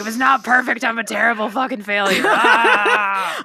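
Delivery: loud, high, laughing panic; hammed-up perfectionist meltdown; mock self-berating yell. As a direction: shout it at yourself, half laughing. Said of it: "I was like".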